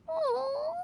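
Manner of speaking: choking up over poor Xiao; tearful pity. Acted. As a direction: near tears, voice catching with pity. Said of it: "no need of sympathy or tears".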